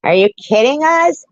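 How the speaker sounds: high, loud disbelief; can't believe what she just heard; incredulous. Real, not acted. Incredulous reaction to clip.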